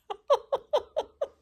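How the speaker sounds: high squealing laugh; gleeful high-pitched laugh. Real, not acted. Delighted by her print.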